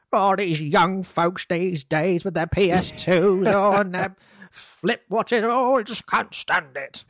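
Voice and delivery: put-on high, slow old-timer grumbling about kids; grumpy old folks voice.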